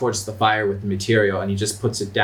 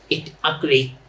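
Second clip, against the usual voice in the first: goblin; 4 semitones higher than usual. Acted. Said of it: "the goblin".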